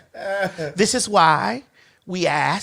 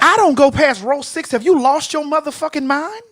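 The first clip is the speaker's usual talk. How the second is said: higher-pitched, incredulous retold outrage; indignant refusal; 4 semitones higher than usual. Star rebuking production over a plane seat, indignant refusal. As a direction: re-enact being affronted, lifting the pitch.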